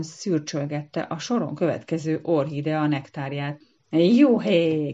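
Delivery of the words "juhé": loud, joyful whoop. A narrator's reading. Tipsy hummingbird whoops after draining the cup, loud. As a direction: cheer like a tipsy bird. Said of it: "kiáltotta".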